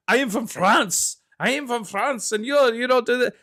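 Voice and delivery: high-pitched, hammed-up bad French accent; mock an actor's clumsy French accent. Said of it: "did he always have that French accent".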